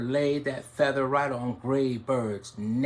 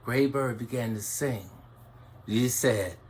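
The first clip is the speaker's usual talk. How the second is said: ordinary delivery, nothing marked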